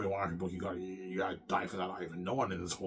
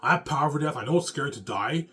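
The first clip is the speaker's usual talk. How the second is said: loud declaration; 5 semitones higher, 7 dB louder than usual. Retold or imitated. Defiant rant about death.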